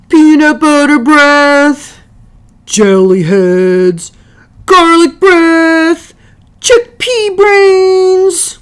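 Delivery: loud, high, slow jeering chant; taunting kids. Acted. Two lunchroom sides jeer at each other, loud, high and drawn out. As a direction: stretch each insult out, sing-song taunt.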